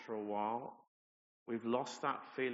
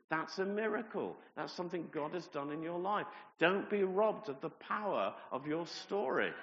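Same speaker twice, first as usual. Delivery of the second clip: raised, higher preaching push; preaching at full force; 6 semitones higher, 5 dB louder than usual. Acted. Pastor urging the church, preaching at full force. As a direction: drive it home to the congregation.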